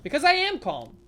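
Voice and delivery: mock-calm that isn't; insisting calm. Real, not acted. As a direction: protest too much that you're calm. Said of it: "I'm acting very calm".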